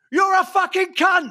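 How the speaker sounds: high, slow, gleeful mock shout; retold yelled insult. Celebrity gets revenge from the corner, retold shouted insult. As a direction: yell it slowly, as if down the street.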